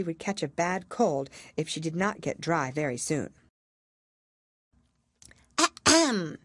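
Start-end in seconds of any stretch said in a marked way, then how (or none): 5.6-6.4 high, pompous throat-clearing; important ahem. A narrator's reading. Mouse clears throat to the crowd, self-important. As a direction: clear the throat grandly like a small official. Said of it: "said the mouse with an important air".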